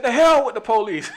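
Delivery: high, loud defiant snap; defiant retort. Retold or imitated. Older lady telling off the police, loud defiant shout. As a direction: throw it back loud and high. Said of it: "She said".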